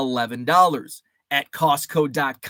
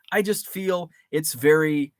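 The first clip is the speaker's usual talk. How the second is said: ordinary talk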